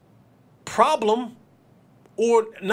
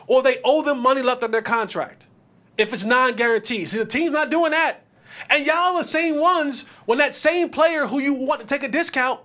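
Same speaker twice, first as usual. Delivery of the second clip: ordinary delivery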